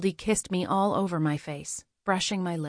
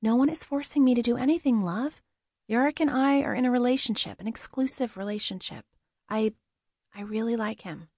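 higher character voice, earnest and a little hesitant; higher woman's voice, softening as it ends; Goldie gently protesting; 5 semitones higher than usual. Acted. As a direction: reassure in a lifted, lighter pitch. Goldie explains her relationship.

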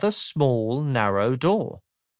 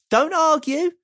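high, louder, brisk insistence; cheerful Mister Happy; 14 semitones higher, 4 dB louder than usual. Acted. Mister Happy brushes off Mister Miserable, bright and brisk. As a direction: cut him off cheerfully.

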